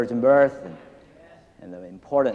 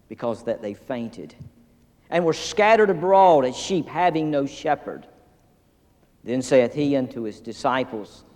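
scripture reading. Acted. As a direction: read the Word with reverence. Reads scripture aloud.

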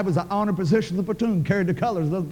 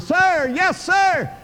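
much higher shout at the top of the lungs; hollering; 11 semitones higher, much slower than usual. Retold or imitated. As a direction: holler it like a scared recruit sounding off. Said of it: "At the top of my lungs, I hollered".

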